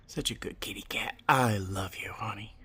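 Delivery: soft unvoiced loving whisper. Real, not acted. Owner whispering praise to her kitty, whisper. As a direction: breathe the praise to the cat.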